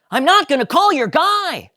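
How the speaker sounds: high, loud refusal; stubborn Jerry. Acted. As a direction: flatly refuse.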